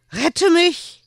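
put-on high, loud panicked child. A narrator's reading. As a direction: beg in panic. Drowning child clings.

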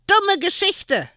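put-on high, slow angry little boy shout; child's angry outburst; screaming child. A narrator's reading. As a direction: yell it in a tantrum. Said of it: "schrie Erwin".

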